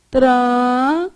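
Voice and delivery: "Tranquilo" slow, with gaps between syllables. Shown as a demonstration. Teacher checks how students separated it, syllable by syllable. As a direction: stretch "Tranquilo" into tran, qui, lo. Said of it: "como é que você separou?".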